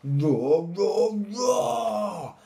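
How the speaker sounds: loud, building, shouted roars; triumphant lion roar. A narrator's reading. Little Lion roaring at last, building loud roars. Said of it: "Yes, he is roaring".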